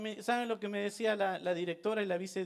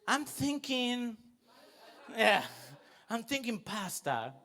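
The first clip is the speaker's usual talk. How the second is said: put-on slow, drawn-out teen deciding; bossy daughter voice; 3 semitones higher, much slower than usual. Daughter announces lunch to her parents, slowly. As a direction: imitate the eldest daughter choosing lunch. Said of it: "la voz presente de la mayor".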